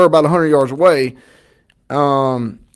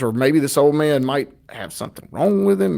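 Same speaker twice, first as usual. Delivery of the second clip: ordinary talk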